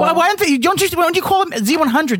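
high indignant. Real, not acted. Arguing with co-host.

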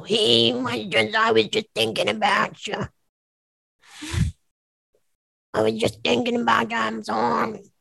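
put-on goblin voice, apologetic; goblin-style voice. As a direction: do a goblin, creeping back with an apology. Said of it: "like, a goblin style voice".

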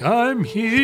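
high, spooky seance spirit voice. Retold or imitated. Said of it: "saying, like".

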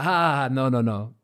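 loud, high imitated "ha ha ha" and dismissal; mocking laugh. Imitating the judge laughing at him, loud mocking laugh. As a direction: belt out a scornful fake laugh, then refuse. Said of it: "he was, like, laughing, laughing at me".

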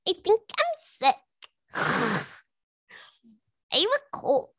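hammed-up morning malingering; pretend sickly morning voice. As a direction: play up being ill, with a feeble sigh. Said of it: "I'm gonna be like this every single morning".

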